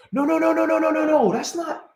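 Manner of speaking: rapid no's, voice jumping high; high disbelieving no's. Real, not acted. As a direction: pitch shoots way up in denial. Realizing he misidentified player.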